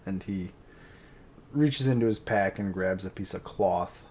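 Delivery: ordinary talk, nothing marked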